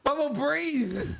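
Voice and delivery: high, loud, drawn-out plea; urgent call. Real, not acted. Friend begging streamer to breathe, high loud call. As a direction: call out to make them stop for air.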